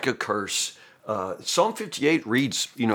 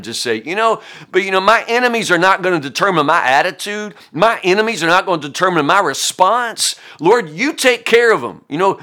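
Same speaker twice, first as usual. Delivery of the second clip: high, loud preacher at full force, building; fiery preaching; 6 semitones higher, 6 dB louder than usual. Acted. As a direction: preach it out, raising the roof.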